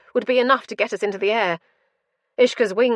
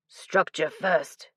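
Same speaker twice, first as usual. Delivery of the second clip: ground out; 2 semitones lower, much slower than usual. A narrator's reading. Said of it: "he ground out as he fought".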